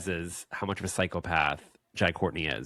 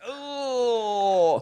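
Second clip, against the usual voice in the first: pained oh; 14 semitones higher, 4 dB louder than usual. Retold or imitated. Reacting to a painful idea.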